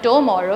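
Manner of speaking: "tomorrow" starting with a full "toe"; wrong full vowel. Shown as a demonstration. Teacher showing a second mistake, "tomorrow" with full "toe". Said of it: "It's not".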